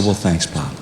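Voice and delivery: low, soft, worn-out father; quiet, pained. Retold or imitated. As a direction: let the words fall low and soft, hurting. Injured dad answering again.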